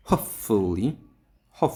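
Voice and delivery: Hopefully with হোপ hit hard; stress on first syllable. Shown as a demonstration. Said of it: "হোপের পরে আমরা stress দেব".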